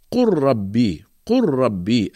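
قل ربي with L dropped into a doubled R; correct merged lam. Shown as a demonstration. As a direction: merge قل into ربي as one stressed R. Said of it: "وإنما تقرأ".

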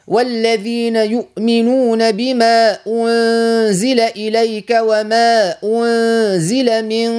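slow teacher's model of the verse, the waw in "والذين" clear; slow model recitation. Shown as a demonstration. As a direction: recite slowly so she repeats it. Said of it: "بيقول لك". (تلاوة نموذجية بطيئة مع إظهار الواو في "والذين")

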